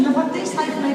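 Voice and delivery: usual speaking voice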